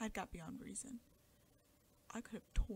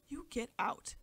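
harsh command; 3 semitones higher, 9 dB louder, slower than usual. A narrator's reading. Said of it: "said the husband to the wife".

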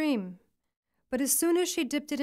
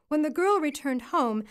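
plain reading voice

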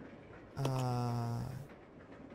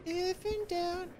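much higher, very slow put-on voice; drawn-out high joke voice; silly catchphrase voice; 14 semitones higher, 3 dB quieter, much slower than usual. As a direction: stretch it out in a high cartoonish voice.

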